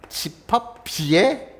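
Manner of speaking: slow dictation-style reading; slow careful reading. Shown as a demonstration. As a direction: read each word out slowly. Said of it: "이렇게 읽어야 돼요".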